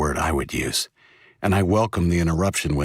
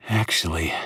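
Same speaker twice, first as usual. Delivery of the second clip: drowsy. Acted. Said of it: "in a drowsy voice".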